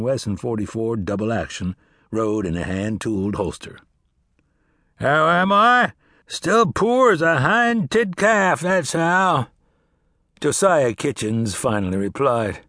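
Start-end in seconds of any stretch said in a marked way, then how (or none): 5.0-9.5 put-on grumbling old farmer, slow and dry. Old farmer answering Cass, wry and grumbling. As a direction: drawl it like a sour old sharecropper.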